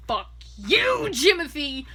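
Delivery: put-on yelling at the boyfriend she can't stand; furious shout. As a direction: blast it at him. Venting about hated boyfriend.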